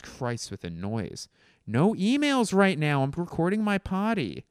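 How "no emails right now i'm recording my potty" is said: high, loud protest; exasperated outburst. Real, not acted. Podcaster scolding incoming emails, exasperated. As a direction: yelp in annoyance at the interruption. Complains about interrupting email noise.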